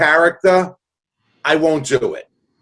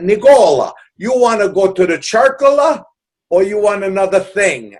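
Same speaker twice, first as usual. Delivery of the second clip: imitated Italian father, higher and louder; Italian accent; 5 semitones higher, 4 dB louder than usual. Italian father offering his child a circus trip, Italian accent. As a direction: put on Dad's heavy Italian accent. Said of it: "with his Italian accent".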